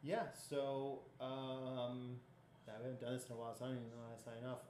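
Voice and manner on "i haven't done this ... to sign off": rushed, slurred mumble; words run together too fast; mumbled fast. Real, not acted. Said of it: "did not sound like English".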